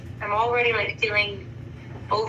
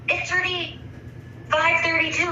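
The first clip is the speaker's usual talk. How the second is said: mock raised voice; 7 semitones higher than usual. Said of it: "he kinda raises his voice at me".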